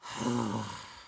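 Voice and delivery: drawn-out breathy sigh; long wheezing sigh. Acted. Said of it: "gave vent to a long, wheezing sigh".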